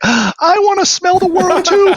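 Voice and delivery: very high, slow, breathless wonder; high, slow, wide-eyed mock awe; awed squeal. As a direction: gasp it out like a starstruck kid. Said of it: "And I was like, gasps".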